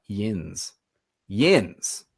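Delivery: "Yins" as a single syllable, rhyming with "bins"; Pittsburgh "yins". Shown as a demonstration. Showing listeners the Pittsburgh word, "Yins" in one syllable. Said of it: "they say yins".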